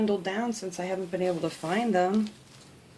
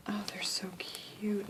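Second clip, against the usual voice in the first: much softer, slow whisper of delight; 8 dB quieter than usual. Real, not acted. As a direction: hush it like a secret. Whispering over stickers.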